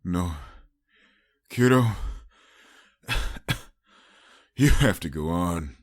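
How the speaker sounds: coughing, hoarse and weak. Acted. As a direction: force words out between coughs. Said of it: "hoarse coughs as he spoke".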